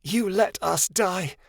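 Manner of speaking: slow, strained croak; a dying voice pressing blame. A narrator's reading. Dying Zaya blames Midnight Star, slow croak. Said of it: "Zaya croaked".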